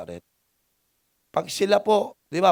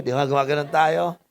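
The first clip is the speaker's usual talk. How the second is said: usual speaking voice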